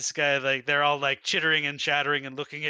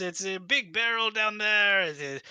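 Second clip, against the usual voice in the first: high, eager broken-English host; dictator voice; 6 semitones higher than usual. Acted. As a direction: sell the barrel with pushy charm. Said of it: "this dictator voice".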